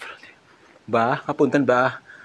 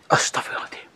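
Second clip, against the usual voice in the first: hushed whispered prayer; 4 dB quieter, faster than usual. Real, not acted. Ghost hunter whispers a prayer in fear, shaken whisper.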